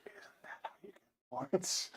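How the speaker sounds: soft conspiratorial whisper. Acted. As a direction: lean in and whisper the question. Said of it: "quietly say".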